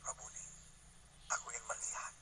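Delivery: very soft, low acted plea; imitated quiet plea; beggar's humble request. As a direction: speak it low and hushed to the teacher. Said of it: "Dia bilang apa?".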